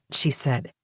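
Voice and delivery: usual speaking voice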